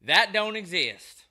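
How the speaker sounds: loud, high mock clerk voice; mocking store employee. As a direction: imitate the dismissive clerk, loud and drawn out. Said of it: "he goes".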